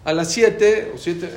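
stretched commanding call; insistent order. Retold or imitated. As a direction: press the command home. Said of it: "así me dijo".

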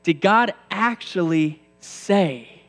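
slow, insinuating devil whisper-tone; sly serpent voice. Acted. Preacher voicing the devil to the congregation, slow and sly. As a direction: drawl it out like a cunning serpent. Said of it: "this is what the devil says".

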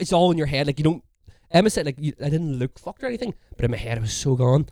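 ordinary talk

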